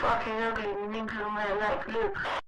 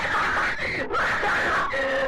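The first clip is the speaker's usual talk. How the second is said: high cackling laugh of triumph; gleeful shriek of laughter; shrieking victory laugh; 9 semitones higher than usual. Real, not acted. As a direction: burst out in a screaming laugh. Laughs after winning round.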